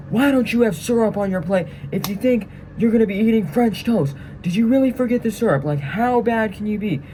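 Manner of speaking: high, loud mock drill-sergeant yell. Sergeant teasing a recruit about forgotten syrup, high loud mock yell. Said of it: "And he's yelling it".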